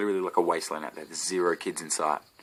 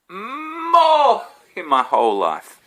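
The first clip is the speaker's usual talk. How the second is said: high, slow wailing lament; mock anguish; 13 semitones higher, 9 dB louder, much slower than usual. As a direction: wail it like a tragedy. Complaining about shopping, drawn out.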